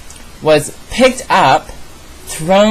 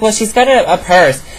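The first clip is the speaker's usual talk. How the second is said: higher-pitched mock imitation of the girl; mimicked excuse; 5 semitones higher than usual. Girl defending leaving her phone with her sister, mimicked.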